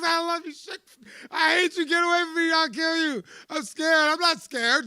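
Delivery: high, loud, crying and torn; tearful outburst. Retold or imitated. Man reenacting a tearful fight with his girlfriend. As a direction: swing between love and hate through tears.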